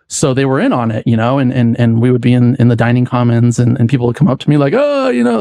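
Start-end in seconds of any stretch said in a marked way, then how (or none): 4.8-5.4 excited greeting. Retold or imitated. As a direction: imitate a delighted friend spotting him. People greeting him as the Prince.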